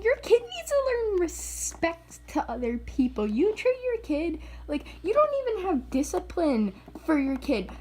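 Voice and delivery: high-pitched indignant lecture; pissed-off telling-off. Retold or imitated. Telling a mom her kid needs respect, pissed off. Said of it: "I was so pissed off".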